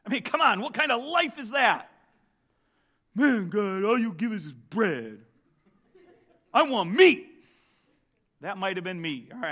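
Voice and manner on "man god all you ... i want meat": grumbling voice that ends in a loud demand for meat; mock complaint. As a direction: play a spoiled complainer.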